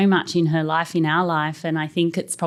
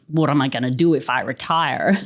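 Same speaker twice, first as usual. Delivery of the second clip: put-on Canadian accent. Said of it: "You said you had a bad Canadian accent".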